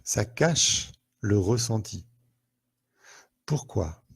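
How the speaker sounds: normal talking voice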